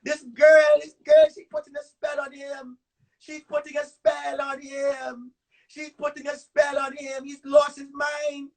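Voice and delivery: hammed-up hysterical mother crying out to God; frantic mother. Mother panicking that a girl put a spell on her son, shouting. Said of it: "my mother was like".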